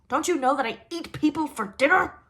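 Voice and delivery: put-on troll yells at the wailing girl; troll's angry warning. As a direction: yell a threat. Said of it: "he warned".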